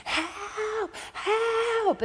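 high mock cries for help; calling out for help, high-pitched; screaming for help. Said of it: "as loud as I could".

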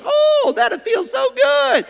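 high, loud, drawn-out gush of craving; tempting flesh. Acted. The flesh making deals with the believer, high giddy craving. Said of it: "your flesh will make deals".

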